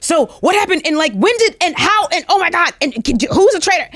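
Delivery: high, rushed re-enacted barrage of questions; eager questioning. Castmates secretly on the phone afterwards, acted-out excited questioning. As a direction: imitate an excited, breathless debrief, tumbling questions.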